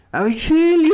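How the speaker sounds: very high, loud hammed-up scream; mock yell for help. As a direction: shriek "auxilio" like a drunk girl. Drunk friend yells for help. (grito agudo y exagerado)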